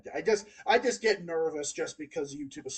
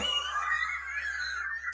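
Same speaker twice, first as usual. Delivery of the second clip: shrieking laugh. Real, not acted. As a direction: let out a squealing laugh of disbelief. Shocked laugh at dark joke.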